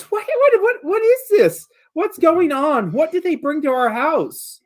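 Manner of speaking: high-pitched, startled mom screech. Acted. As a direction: screech it as an alarmed mother, very high. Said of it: "lets out a little screech".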